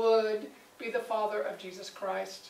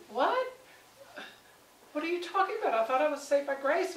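incredulous protest; 5 semitones higher than usual. Retold or imitated. Confused believer objecting.

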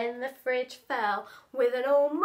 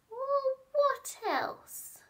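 high, slow taunting drawl; sneering; 13 semitones higher, much slower than usual. Acted. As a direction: dare her. Cornelius sneering.

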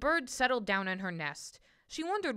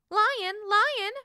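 high, loud, astonished little bird; a high, chirpy bird voice, amazed; astonished chirp; 12 semitones higher, 7 dB louder than usual. A narrator's reading. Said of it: "the bird chirped, astonished".

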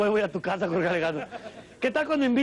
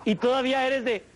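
ordinary talk, nothing marked

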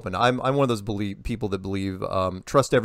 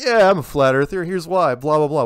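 higher and louder, brash mock confidence; mock proud declaration; 5 semitones higher, 7 dB louder than usual. Basketball star boasting about flat Earth, mock brash and loud. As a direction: toss it off like a cocky star. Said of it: "And he's like".

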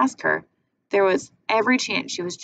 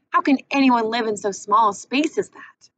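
incredulous; 5 semitones higher, 4 dB louder than usual. Acted. Bruno outraged at small house.

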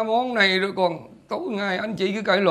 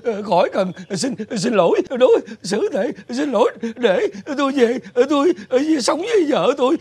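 halting, hammed-up sobbing; unstoppable crying. Father refuses apology and wants to leave, sobbing. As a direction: sob between each short phrase. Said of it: "ông khóc nín không dứt luôn".

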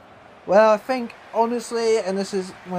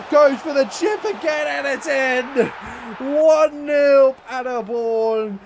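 much higher, slow, shouted goal call; 6 semitones higher than usual. Real, not acted. His own chip goes in.

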